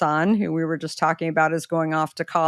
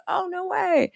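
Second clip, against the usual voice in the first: much higher squeal of surprise; high, excited "no way"; retold delighted disbelief; 13 semitones higher than usual. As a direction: sound thrilled and amazed. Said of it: "And I said".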